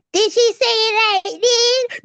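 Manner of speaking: high, slow put-on voice; acting out a voice. Daughter made to act out the teacher for mom, high put-on voice. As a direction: draw it out high, mimicking someone. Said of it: "It had to be a voice".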